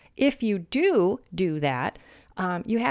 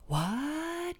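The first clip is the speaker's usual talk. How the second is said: mock gasp; 4 semitones higher, 8 dB quieter than usual.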